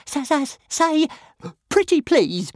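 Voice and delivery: high, squeaky dragon pleading defiantly; high squeaky little dragon, choking out a defiant plea; defiant choked plea. A narrator's reading. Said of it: "choked Toothless defiantly".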